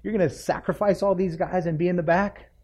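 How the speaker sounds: a voice apart, rising in mock disbelief at Jacob; incredulous listener. The preacher voices a doubting listener, mock disbelief. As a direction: play the doubting onlooker with a scoff. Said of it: "And you're thinking".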